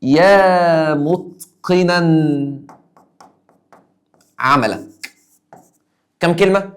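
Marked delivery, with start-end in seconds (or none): 0.0-4.9 each word stretched out very slowly; slow demo. Shown as a demonstration. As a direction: spell out every syllable for the class. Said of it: "لما أقول له". (نطق بطيء جدًا وممدود)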